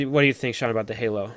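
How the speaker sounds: ordinary delivery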